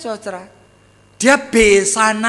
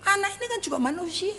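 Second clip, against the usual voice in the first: softer, quick, tender rebuke; gentle Jesus; 6 semitones higher, 10 dB quieter, faster than usual. Retold or imitated. Jesus gently correcting the disciples about the children, softer and tender. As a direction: reassure them softly and quickly.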